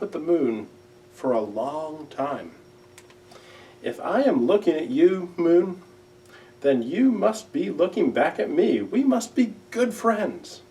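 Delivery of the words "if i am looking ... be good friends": a lightly voiced, higher little owl; gentle Owl. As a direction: talk up to the moon like a shy friend. Owl talks to the moon.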